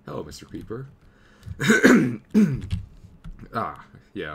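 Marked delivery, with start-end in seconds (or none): 0.9-3.5 loud, forceful throat clear. Real, not acted. Sick gamer clears his throat after greeting a creeper. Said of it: "I'm get kinda sick".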